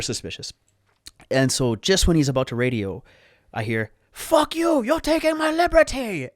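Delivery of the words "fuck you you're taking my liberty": high, loud freak-out scream; outraged shriek for the whole airport; imitated full scream. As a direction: imitate a man screaming at the top of his lungs. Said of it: "he screamed this at the top of his lungs".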